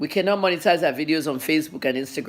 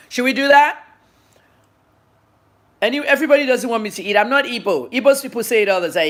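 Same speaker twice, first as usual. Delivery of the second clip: exasperated outburst; 7 semitones higher, 6 dB louder, faster than usual. Real, not acted. Annoyed at commenters about eating.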